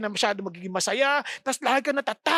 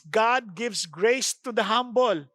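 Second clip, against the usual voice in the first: slow proclamation; 2 semitones lower, slower than usual. Acted. Key verse proclaimed slowly.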